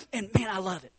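ordinary talk, nothing marked

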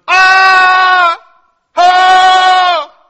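very high, very loud screaming Ah; imitated scream. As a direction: belt out the panicked passengers' scream. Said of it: "We got guys screaming".

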